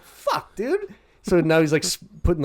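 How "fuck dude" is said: much higher pitched exasperated cry; mock dismay. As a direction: squeak out the dismay.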